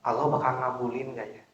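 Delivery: soft, low wondering; doubtful musing. Acted. Preacher imitates worshippers after praying, quietly doubtful. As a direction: mutter a small doubt to yourself. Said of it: "Bapak Ibu ngomong kayak gini".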